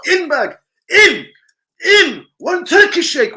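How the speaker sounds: high, loud mock calls; staff calling orders. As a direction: rattle off orders like busy staff.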